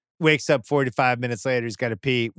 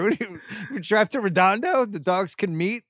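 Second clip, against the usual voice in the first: much higher, disbelieving retort; incredulous; 7 semitones higher than usual. Retold or imitated. Husband mocking wife's neutral-territory idea, incredulous and much higher. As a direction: scoff at the idea, voice jumping up. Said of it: "I'm like".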